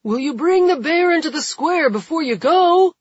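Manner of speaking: high, loud call from a crowd; shout. A narrator's reading. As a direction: shout the question out. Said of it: "someone shouts".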